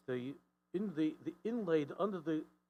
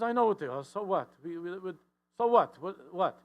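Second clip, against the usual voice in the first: louder, higher mock exasperation; husband snapping back so what, raised voice; 1 exasperated retort; 4 semitones higher, 9 dB louder than usual. Shrugging it off at his wife, loud and exasperated.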